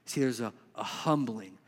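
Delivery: ordinary talk, nothing marked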